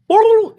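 high, loud gobbling call; turkey call. Retold or imitated. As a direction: bellow it like a turkey.

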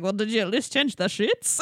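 playful Russian-accented impression; cute Russian accent. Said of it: "cute little Russian accent".

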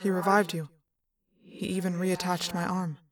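even mind-voice with reverb; grave, understated alien character; sober echoing alien. Acted. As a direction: give a measured report as an echoing alien.

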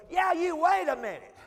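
loud, high, slow call; calling after. Retold or imitated. Stranger running after the preacher, loud drawn-out call. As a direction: stretch it out to stop someone leaving.

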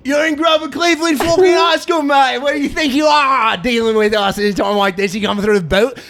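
swagger as a wasteland boss with a wobbly Australian twang; a hint of an Australian accent; British-Australian accent. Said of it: "You sounded British at the start".

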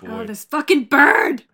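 loud, slow angry outburst; angry shout. Real, not acted. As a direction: yell it at the bird. Player shouts at monster bird.

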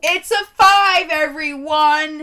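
loud, slow, drawn-out declaration to the audience; proud announcement. Real, not acted. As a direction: proclaim the score like a game show host. Announcing the final rating.

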